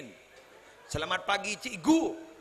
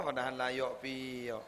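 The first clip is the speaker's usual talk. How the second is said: ordinary delivery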